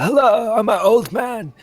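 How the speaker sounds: put-on high, loud old-man character voice. Shown as a demonstration. Game player demonstrates the character's voice, as a loud high old man. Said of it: "so that's the voice".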